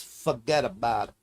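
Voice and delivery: "forget about it" with a New York accent, dropping the r in "forget". Shown as a demonstration. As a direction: say "forget about it" run together like a New Yorker. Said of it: "say it like you're from New York".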